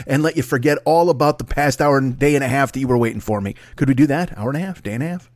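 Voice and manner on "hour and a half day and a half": muttered. Real, not acted. Muttering to himself.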